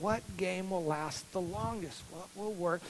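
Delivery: quiet, slow thinking aloud; hushed kid weighing his last quarter; murmured pondering. Retold or imitated. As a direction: mull it over under your breath.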